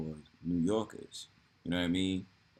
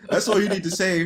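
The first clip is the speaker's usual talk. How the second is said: laughing through the words, higher and louder; 9 semitones higher, 7 dB louder than usual. Real, not acted. Laughing as he agrees with his friend. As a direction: say it while still cracking up.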